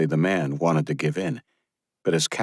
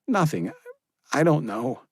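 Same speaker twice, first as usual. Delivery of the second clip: put-on upset young woman; 10 semitones higher than usual. Josephine upset.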